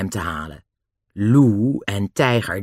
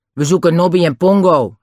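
loud, higher-pitched surly demand; a touch of gruff menace, pushed louder; 6 semitones higher, 6 dB louder than usual. A narrator's reading. Lou confronts Dick looking for Nobby and Pongo, gruff. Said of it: "zei Lou nors".

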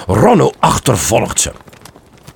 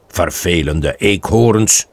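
grumbling; 4 semitones lower, slower than usual. Acted. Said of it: "gromt hij".

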